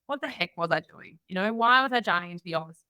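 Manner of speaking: ordinary delivery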